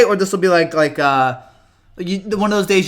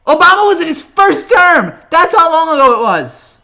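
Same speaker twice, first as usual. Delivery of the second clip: exasperated rant; 10 semitones higher, 8 dB louder than usual. Real, not acted. Exasperated rant about the drought.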